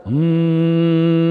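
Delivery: slow, steady yogic hum; long hummed mm. Shown as a demonstration. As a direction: hold a long even humming tone. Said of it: "मकार का उच्चारण". (लंबा, स्थिर गुंजन)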